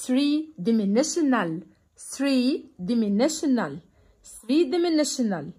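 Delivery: three dimensional said slowly, stress on three; slow repetition. Shown as a demonstration. Teacher drilling pupils, slow clear repetition. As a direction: repeat it slowly for the class to copy. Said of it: "تعالوا نشوف كده".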